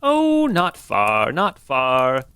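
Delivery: put-on soothing old innkeeper; old lady voice. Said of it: "one old lady voice".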